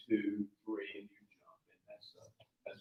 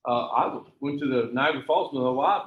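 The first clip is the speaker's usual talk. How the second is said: ordinary delivery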